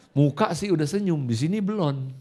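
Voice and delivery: ordinary talk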